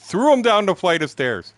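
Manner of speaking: loud, high-pitched exclamation; punched out loud and high; emphatic outburst. Real, not acted. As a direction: raise your voice in disbelief at what happened. Stressing a shocking memory loudly.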